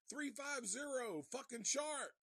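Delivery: high, loud shouted sighting; retold alarm call. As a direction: yell out the sighting in mock alarm. Retold alarm over the radio.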